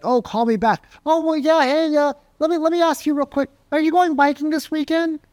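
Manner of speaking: high, breezy dodge of a phone call; mock-casual excuse to hang up; brushed-off prospect. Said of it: "when their walls go up and like".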